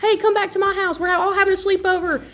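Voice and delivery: high-pitched imitation of a friend; retold friendly invitation. Singer voicing Lainey inviting her to a sleepover, high imitation. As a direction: pitch it up, calling someone along. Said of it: "And then she was like".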